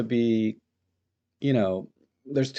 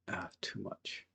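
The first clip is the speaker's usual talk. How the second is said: breathy whisper, almost no voice; whispered to himself; 3 semitones lower, 16 dB quieter, slower than usual. Real, not acted. Photographer whispering to himself at his editing screen. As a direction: mutter it under your breath as the edit goes too far.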